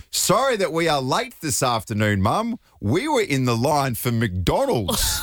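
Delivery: high, stiff overacted kid apology, slow at the end; a child faking an innocent excuse; weird put-on voice. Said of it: "almost put on this weird different voice".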